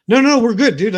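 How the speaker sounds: loud, high, quick waving-off; emphatic reassurance. Retold or imitated. Telling his inner critic Chucky it's fine, loud emphatic reassurance. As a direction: brush off the worry with big reassurance.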